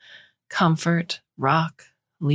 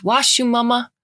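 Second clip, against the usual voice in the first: put-on a little boy insisting; bossy toddler; 7 semitones higher than usual. Toddler telling his mother to wash, high bossy child voice.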